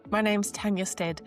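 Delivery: ordinary talk, nothing marked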